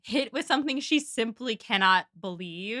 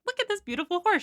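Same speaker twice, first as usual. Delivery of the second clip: excited Marianne quote; 7 semitones higher, faster than usual. Acted. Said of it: "Marianne is like".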